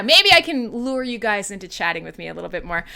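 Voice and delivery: normal talking voice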